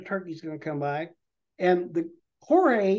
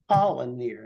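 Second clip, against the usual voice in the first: ordinary delivery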